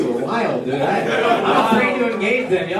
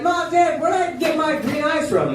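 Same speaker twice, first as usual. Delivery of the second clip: put-on kid asking parents; 10 semitones higher than usual. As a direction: call out to mom and dad like a kid. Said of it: "I used to ask them".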